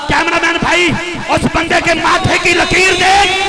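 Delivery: loud, high rallying shout with drawn-out chant; full-force preaching. Real, not acted. Preacher urging the crowd to raise hands and chant, full-force shout. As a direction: belt out the slogan for a crowd to echo.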